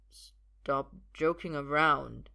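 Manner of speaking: slow, woozy man's voice; sluggish, uneasy protest; drugged disbelief. Acted. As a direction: drag the words out drunkenly. Drugged Arthur disbelieves her.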